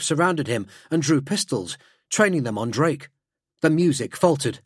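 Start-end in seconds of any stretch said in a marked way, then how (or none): none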